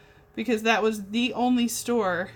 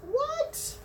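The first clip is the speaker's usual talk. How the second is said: surprised what; 4 dB quieter than usual. Real, not acted. Puzzled while searching for pens.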